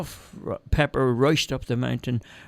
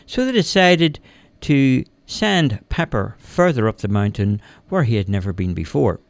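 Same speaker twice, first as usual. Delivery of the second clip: ordinary delivery, nothing marked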